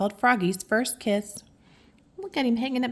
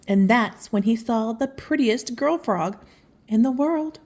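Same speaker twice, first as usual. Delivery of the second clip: plain reading voice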